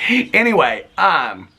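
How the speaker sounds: ordinary delivery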